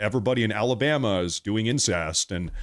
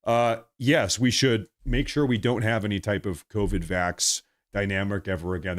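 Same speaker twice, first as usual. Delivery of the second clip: ordinary talk, nothing marked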